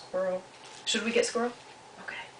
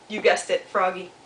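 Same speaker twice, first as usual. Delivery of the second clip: ordinary delivery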